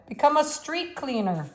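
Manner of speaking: a hint of Russian accent, pushed hard; strong, forceful voice. A narrator's reading. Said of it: "He had a strong voice".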